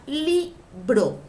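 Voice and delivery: libro slowly broken into LI, then BRO; split into syllables. Shown as a demonstration. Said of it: "Si yo digo, por ejemplo, la palabra".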